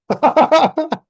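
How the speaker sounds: loud, high burst of laughter; real laugh. Real, not acted. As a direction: crack up out loud. Real laugh at wife's reaction.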